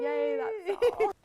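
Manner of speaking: high, slow, bubbly yay; cheery. Pretend. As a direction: sign off with a sugary cheer. Cheery sign-off.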